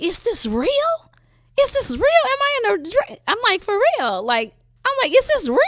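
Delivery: high, amazed repeated questions; stunned disbelief. Retold or imitated. Asking herself in the dream if her daughter is really there, amazed. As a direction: ask it like you can't believe your eyes.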